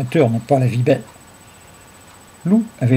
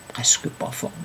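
near-whisper, much softer; hushed; 7 dB quieter than usual. A narrator's reading. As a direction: trail down into a whisper. Confides a furtive wish.

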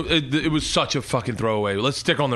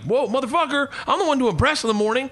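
mock outrage; 12 semitones higher than usual. Mocking indignant reaction.

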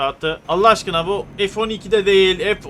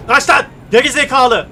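angry shout; 5 semitones higher, 7 dB louder than usual. Real, not acted. Yelling at a honking driver.

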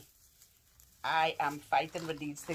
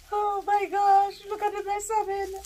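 high squeal; 4 dB louder than usual. Real, not acted. Tangled in gift wrapping, telling viewers, a high squeal. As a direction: squeal it in delighted disbelief.

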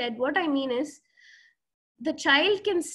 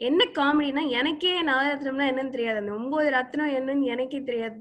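normal talking voice

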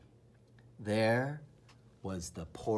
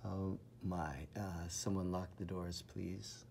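very soft worried aside; hushed mock worry; 4 semitones lower, 12 dB quieter than usual. A narrator's reading. Narrator hushes a mock warning to listening children. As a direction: whisper a mock warning to the kids.

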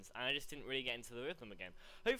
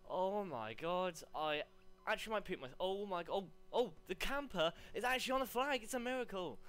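raised pitch, thrilled disbelief; amazed excitement; 5 semitones higher than usual. Real, not acted. Gamer spots a camper on the flag, amazed. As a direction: blurt it out in rising amazement.

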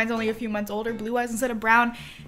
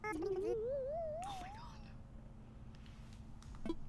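high soft breath of awe; breathless awe; 17 dB quieter, much slower than usual. Real, not acted. Overwhelmed, softly.

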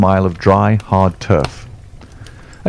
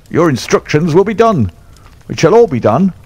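cowed promise; 9 semitones higher, 3 dB louder than usual. Acted.

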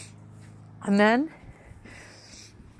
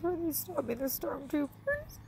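real tears, voice pitched higher and very soft; crying; 8 semitones higher, 10 dB quieter than usual. Real, not acted. Tearful retelling of vision.

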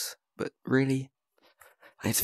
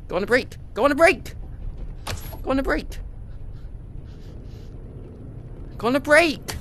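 high pitched repeating; 9 semitones higher, 6 dB quieter, faster than usual. Real, not acted.

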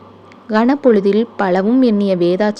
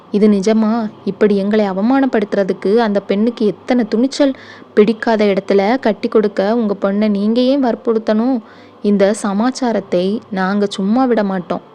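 plain reading voice

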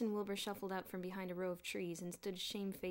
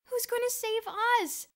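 high, loud insistent demand; 11 dB louder than usual. A narrator's reading. Boots demands an answer from Bruno, loud and high. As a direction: throw the question back at him. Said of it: "demanded Boots".

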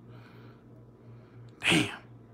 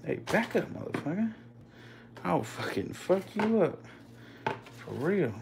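high, soft mock threat; 9 semitones higher, 9 dB quieter than usual. Real, not acted. Mock threatening the rattlesnake in a high soft voice. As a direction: warn the snake off playfully.

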